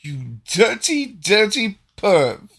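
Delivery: high, slow, loud taunt; hammed-up teasing accusation; scandalized accuser. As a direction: draw out each word in mock outrage. Game master voices Delgar's mock scandal.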